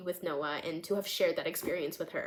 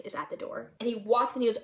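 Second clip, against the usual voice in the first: usual speaking voice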